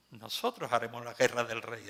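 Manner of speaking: normal talking voice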